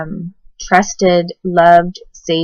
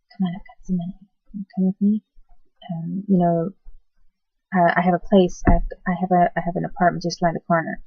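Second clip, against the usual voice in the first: quiet coaxing stranger; 7 dB quieter, faster than usual. Retold or imitated. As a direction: coax softly, like luring someone. Stranger luring a child.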